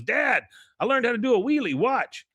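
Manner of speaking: put-on high, eager boy's voice; a kid showing off a wheelie to Dad; lightly voiced excited boy. Bobby excited.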